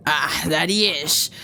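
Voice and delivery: low, lightly piratical reply; pirate voice. Acted. Monk answers about reading Italian, low pirate voice. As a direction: drop the pitch into a hint of pirate. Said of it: "let's give him a pirate t-, voice".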